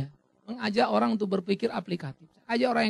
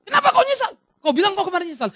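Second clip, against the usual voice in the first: high, loud demanding question; challenging pastor; 10 semitones higher, 6 dB louder than usual. Acted. Pastor challenges the released prisoner, high and loud. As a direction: snap back at him, much higher.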